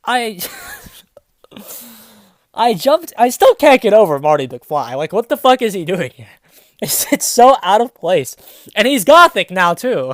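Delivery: loud giggling through the words; laughing. Real, not acted. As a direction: crack up at the absurd plot. Breaks out laughing at the story.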